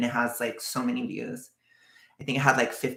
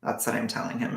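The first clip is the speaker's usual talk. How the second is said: usual speaking voice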